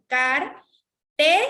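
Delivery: Car, TE, with te jumping up; rising stressed syllable. Shown as a demonstration. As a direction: separate the syllables and push te upward. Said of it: "va más arriba".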